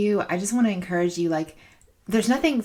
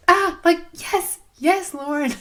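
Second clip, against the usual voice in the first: high, loud bursts of yes; a loud, high, thrilled cheer for Lauren; delighted cry; 10 semitones higher, 6 dB louder, slower than usual. Real, not acted. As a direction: gush with real delight. Delight after reading the lyrics.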